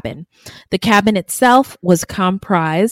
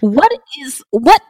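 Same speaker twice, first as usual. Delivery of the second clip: higher, stammered incredulity; baffled disbelief; 6 semitones higher than usual. Pretend. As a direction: break off as if lost for words. Baffled outburst at the absent woman.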